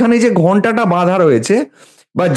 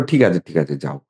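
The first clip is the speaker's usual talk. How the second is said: forgiving; 14 semitones lower, 6 dB quieter than usual. Retold or imitated. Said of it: "এখানে বলো".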